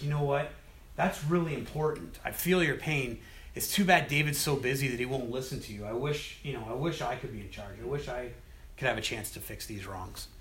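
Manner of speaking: a scheming prince softly winning over a complainer; sly sympathy. Retold or imitated. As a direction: lean in and flatter him quietly. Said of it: "he's sneaky, he's conniving".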